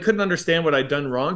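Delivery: ordinary talk, nothing marked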